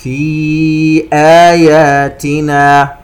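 slow chanted "في آياتنا", long held ee in "في"; separated madd. Shown as a demonstration. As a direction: stretch "في" four counts before "آياتنا". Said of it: "مد منفصل". (ترتيل بمد "في" أربع حركات)

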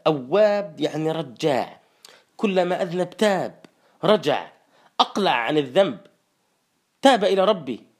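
ordinary talk, nothing marked

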